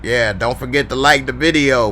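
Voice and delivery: normal talking voice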